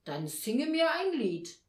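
a hint of stern Nikolaus; stern command. A narrator's reading. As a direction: demand it like a strict examiner. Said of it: "sah sie so streng an".

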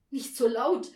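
loud scolding hush; called-out warning. A narrator's reading. Mother warns the playing family, loud call. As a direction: call out to quieten them.

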